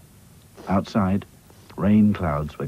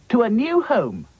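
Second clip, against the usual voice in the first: Dotty's voice; 4 dB louder than usual. Acted. Dotty answers.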